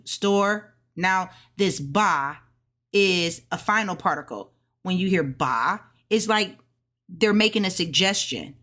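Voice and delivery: ordinary delivery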